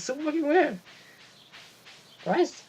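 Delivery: high, cracking voice. Real, not acted. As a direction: voice breaking up high. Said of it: "that is making my voice crack".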